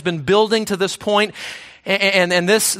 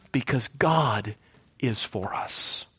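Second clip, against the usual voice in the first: hushed answer; 9 semitones lower, 9 dB quieter, slower than usual. Real, not acted. Pastor answering the fearful listener in a hushed, slow voice. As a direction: drop to a near whisper, slow.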